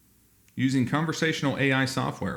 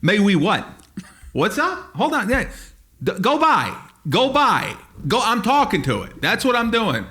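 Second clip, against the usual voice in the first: high, agitated confrontation; hammed-up indignant challenge; mock belligerent American; 8 semitones higher than usual. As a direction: wave off bystanders while squaring up to someone. Acting hothead confronting the robot.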